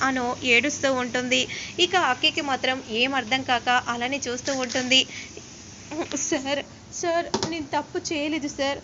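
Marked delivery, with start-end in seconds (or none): 6.3-8.8 tearful plea. A narrator's reading. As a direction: sob out your innocence. Anu crying clinging to Arya.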